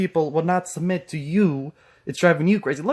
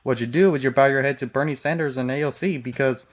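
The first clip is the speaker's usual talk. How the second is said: ordinary talk, nothing marked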